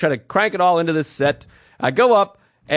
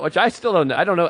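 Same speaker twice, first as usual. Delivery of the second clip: ordinary talk, nothing marked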